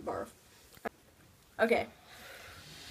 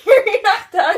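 laughing; 7 semitones higher, 7 dB louder, slower than usual. Real, not acted. Laughing at friend still chewing.

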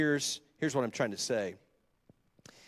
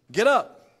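commanding call; 7 semitones higher, 8 dB louder than usual. Acted. God's voice in the vision.

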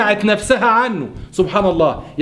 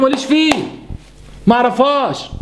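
high, dismissive refusal; dismissive wife; 6 semitones higher than usual. Retold or imitated. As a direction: brush him off with a raised voice. Wife refuses to help husband. (زوجة رافضة بصوت عالٍ)

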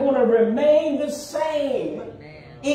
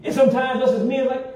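ordinary talk, nothing marked

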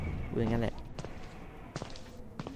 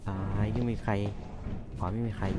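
hushed checking; 4 semitones lower, 13 dB quieter than usual. Real, not acted. Sneaking past the ghost.

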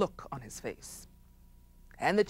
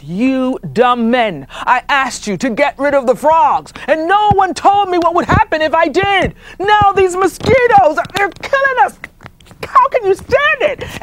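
angry chief yelling; 10 semitones higher, 14 dB louder than usual. Acted. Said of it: "He said".

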